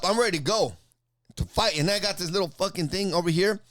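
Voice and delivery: ordinary delivery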